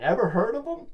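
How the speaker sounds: mock-surprised high question; sarcastic. Sarcastic aside about Einstein.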